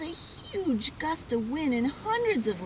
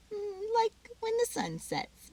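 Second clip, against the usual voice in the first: tender; 12 semitones higher than usual. Acted. Mom agrees tenderly.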